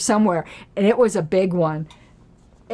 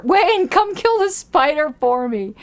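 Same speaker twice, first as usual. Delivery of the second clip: raised, higher-pitched call for help; 8 semitones higher than usual. Real, not acted. Host calls someone to come kill the spider, calling out. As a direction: call to someone in another room.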